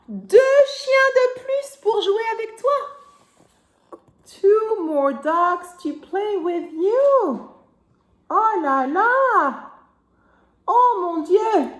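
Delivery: high gasp, slow sing-song wonder; surprised reveal. Acted. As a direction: gasp and marvel at it. (émerveillement surpris)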